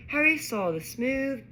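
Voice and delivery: usual speaking voice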